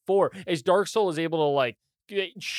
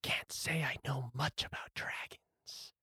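very soft, breath-only golem voice; raspy whisper; 12 dB quieter than usual. Acted. Golem answering the player's question about dragons, raspy whisper. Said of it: "the one that's been talking to you".

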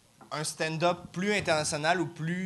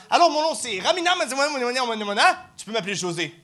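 comedian impression; 6 semitones higher, 3 dB louder, faster than usual. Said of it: "j'ai juste vu un arabe faire".

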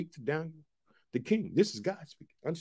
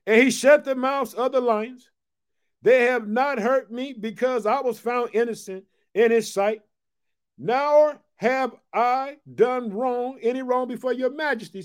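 higher, raised proclaiming, very slow on "Nor have I done wrong"; scripture reading; 7 semitones higher, 5 dB louder than usual. Acted. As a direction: declare Daniel's innocence to the king. Preacher reads scripture aloud.

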